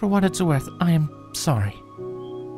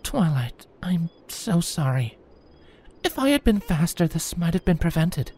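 remorseful apology; 9 semitones higher, 4 dB quieter than usual. A narrator's reading. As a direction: comfort her with guilt in it. Celestia apologising to Twilight.

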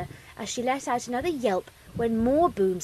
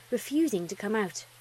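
ordinary delivery, nothing marked

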